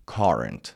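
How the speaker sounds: British "current" with an open first vowel, falling; British pronunciation. Shown as a demonstration. Teacher showing students the British variant, British "current".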